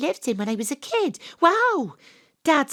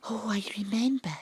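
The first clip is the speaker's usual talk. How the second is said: fast soft whisper; hushed aside; 6 dB quieter, faster than usual. Acted. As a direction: whisper the memory. Hushed recollection.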